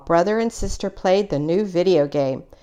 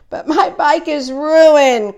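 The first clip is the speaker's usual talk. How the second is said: higher, louder, slow distressed cry; 9 semitones higher, 6 dB louder, slower than usual. A narrator's reading. Brother crying out to Mama about his ruined bike. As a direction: plead over the broken bike. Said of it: "cried Brother".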